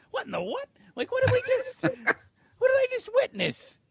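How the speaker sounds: high, loud mock-shocked squeal; stunned disbelief. Dad driving with kids sees a shock, high mock astonishment. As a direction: shoot way up in comic disbelief. Retold shock at shirtless man.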